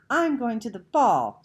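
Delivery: put-on high, loud little-girl doll voice; high pretend voice. A narrator's reading. Little girl voicing her paper doll going to the ball. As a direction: announce it in a make-believe doll voice. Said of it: "she announced in a high pretend voice".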